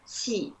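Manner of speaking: 气 with lips spread flat, air through the teeth; flat-lipped qi sound. Shown as a demonstration. Teacher shows student how to form 气, flat-lipped and airy. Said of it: "make it quite flat".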